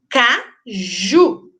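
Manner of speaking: "caju" split, with JU strong and held; stress on last syllable. Shown as a demonstration. Teacher demonstrating an oxítona word, stress on last syllable. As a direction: hit the final syllable of "caju". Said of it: "a mais forte é a última sílaba".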